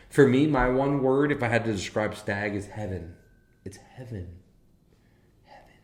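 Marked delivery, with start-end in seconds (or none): 2.5-5.8 heaven fading softer each time to a breath; dreamy, dying-off heaven; fading reverent heaven. Real, not acted. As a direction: say heaven and let it fade away. Savoring the word heaven.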